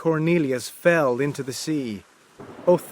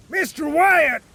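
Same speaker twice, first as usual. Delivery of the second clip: high, loud call across the deck; captain shouting; 13 semitones higher, 5 dB louder than usual. Acted. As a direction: yell his name to get his attention. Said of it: "shouted the captain".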